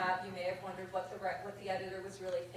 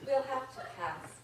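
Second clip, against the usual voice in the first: deadpan mock-formal letter read in verse; reading a mock rejection letter poem over audience laughter; comic poem reading; 11 dB louder than usual. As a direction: read it like a snooty editor's form letter.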